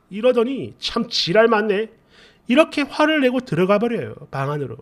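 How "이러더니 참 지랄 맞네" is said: normal talking voice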